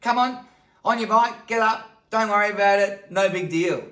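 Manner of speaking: loud, high, hearty brush-off; acted no-nonsense Aussie father; brusque dad. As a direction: chivvy a crying kid back onto the bike. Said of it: "He's like".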